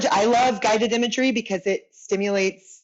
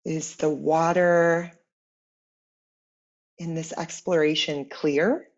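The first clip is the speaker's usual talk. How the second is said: guided meditation; 3 semitones lower, 4 dB quieter than usual. Acted. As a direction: guide them gently inward, unhurried, letting each phrase settle. Leads guided imagery meditation.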